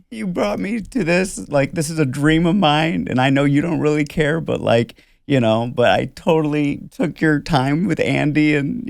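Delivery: lightly imitated apology, pitched high; a high, mildly remorseful retelling; feeling bad. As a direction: play her sheepish apology lightly. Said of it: "She felt so bad though after".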